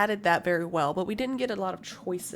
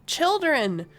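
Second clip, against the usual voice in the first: loud shout; 4 semitones higher, 7 dB louder than usual. Acted. Shout from off to the side, loud. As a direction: shout it at full volume.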